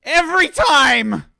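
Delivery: high, loud drawn-out yell; slow, fed-up in-character holler; exasperated yelling. Acted. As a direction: yell it at a bungling partner. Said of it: "yelling at you".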